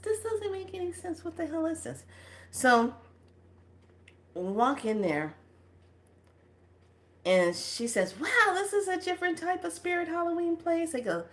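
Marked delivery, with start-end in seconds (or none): 0.0-2.0 high, softened voice of confusion; mock bewilderment. Dreamer looking at ceiling ornaments, mock bewilderment. 8.2-11.0 high-pitched imitation of a youngster; young companion's wonder. Young one walking into odd room, high-voiced wonder.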